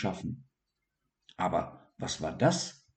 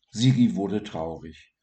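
ordinary delivery

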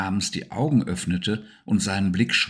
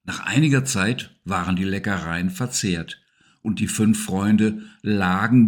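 plain reading voice

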